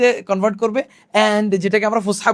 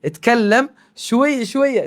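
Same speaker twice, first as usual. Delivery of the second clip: slow demo; 3 semitones higher, much slower than usual. Shown as a demonstration. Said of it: "local আঞ্চলিক ভাষা আমরা বলবো".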